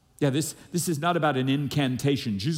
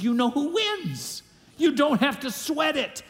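much higher, fired-up preaching; rousing encouragement; 10 semitones higher than usual. Real, not acted. Preacher sending the team members out to be bold, in a rousing tone. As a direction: lift them up with it.

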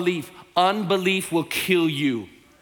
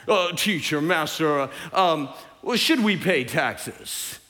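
low, halting, fake deference; phony questioner; 5 semitones lower than usual. Pharisees trying to trap Jesus, phony halting politeness. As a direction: feign respect, hesitating, slow to finish. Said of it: "Who talks like that?".

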